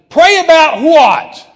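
high, drawn-out bellowed question; yelled. Retold or imitated. Guest rounding on the preacher who stopped him, yelled. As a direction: explode in an incredulous yell. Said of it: "And I just yelled at him".

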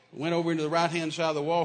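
ordinary delivery

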